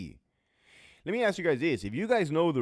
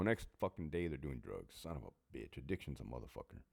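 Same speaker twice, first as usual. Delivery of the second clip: ordinary talk, nothing marked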